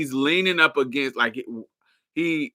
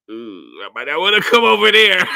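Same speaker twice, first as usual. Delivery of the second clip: side-eyed scaredy mock; 7 semitones higher, 9 dB louder than usual. People hearing North Borough, hammed-up nervous squeal. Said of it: "side eye you like".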